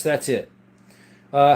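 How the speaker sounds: ordinary delivery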